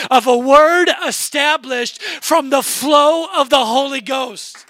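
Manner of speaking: high, driving preacher voice with stretched key words; fervent revival-style testimony; impassioned preaching. Real, not acted. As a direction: preach it at full force, punching the big words. Preaches at rising full force.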